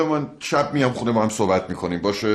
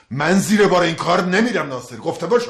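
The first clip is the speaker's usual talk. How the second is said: raised, louder angry voice; angry refusal; 5 semitones higher, 4 dB louder than usual. Acted. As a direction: put your foot down hotly. Refuses the plan in a quarrel. (با عصبانیت و قاطعانه)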